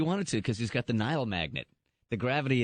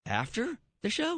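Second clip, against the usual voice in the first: shocked; 9 semitones higher, slower than usual. Real, not acted. Friend shocked by her story.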